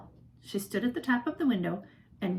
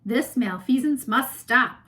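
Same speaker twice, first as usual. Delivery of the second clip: deep heroic voice; 4 semitones higher, 8 dB louder, slower than usual. A narrator's reading. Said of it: "in a deep, superhero-, heroic voice".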